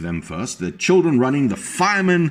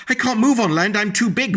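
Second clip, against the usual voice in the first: moaning whale; 10 semitones higher than usual. A narrator's reading. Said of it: "moaned the whale".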